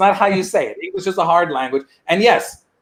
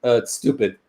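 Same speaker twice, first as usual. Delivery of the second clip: lower, softer, dismissive "it's stupid"; dad waving off baseball, lower and softer; immigrant dad voice; 7 semitones lower, 5 dB quieter than usual. Retold or imitated. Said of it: "They say".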